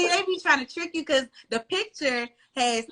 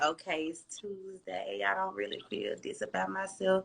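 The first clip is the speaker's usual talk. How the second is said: much softer, slow, deflated inner voice; low mood self-doubt; 6 dB quieter than usual. Retold or imitated. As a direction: murmur it like a tired morning doubt. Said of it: "be feeling like".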